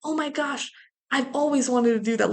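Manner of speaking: imagine a thrilled reaction, lightly acted; excited. Podcaster imagining the line the film lacked, mildly excited. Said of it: "Tommy's so excited about this".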